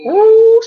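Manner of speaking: a sudden high, loud squeal of ooh. Real, not acted. Vlogger shrieks ooh about her sharp tongue. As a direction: shriek ooh in mock alarm. Exclaims at own sarcasm.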